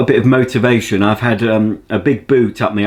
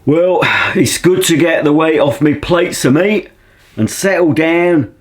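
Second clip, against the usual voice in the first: manly bloke voice; 5 semitones higher, 5 dB louder than usual. Acted. To the viewers over his coffee, a louder hammed-up manly bloke. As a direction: ham up a manly working man having his coffee.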